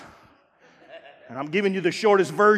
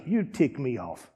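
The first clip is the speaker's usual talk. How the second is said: slow, low, blunt put-down; deadpan, low and slow; tick me off; 9 semitones lower, 8 dB quieter, slower than usual. Retold or imitated. Preacher telling the member off, low and deadpan. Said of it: "I'm gonna look at you and say".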